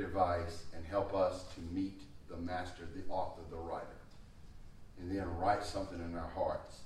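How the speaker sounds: soft, low prayer voice. Real, not acted. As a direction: pray quietly over the word. Closing prayer after reading.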